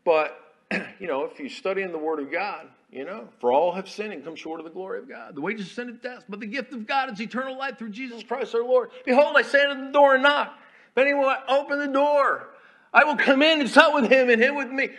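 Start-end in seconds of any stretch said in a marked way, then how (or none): 3.4-14.9 high, loud preaching at full force; preaching scripture. Acted. Chaplain preaching scripture to the congregation, full force. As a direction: ring the verses out from the pulpit.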